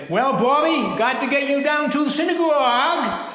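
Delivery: put-on grandfather's broad Maine accent, high and loud. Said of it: "I can hear him now saying".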